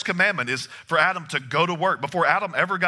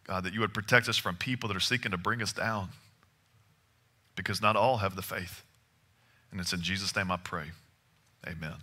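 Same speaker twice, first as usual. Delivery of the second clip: quiet, hushed prayer voice, lower; 7 semitones lower, 8 dB quieter than usual. Acted. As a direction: pray softly and earnestly. Closing prayer before sermon.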